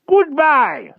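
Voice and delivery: high, loud owl-like farewell; an owl's fond parting cry; tender owl. A narrator's reading. Archimedes the owl bidding Wart a high loud farewell. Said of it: "said Archimedes tenderly".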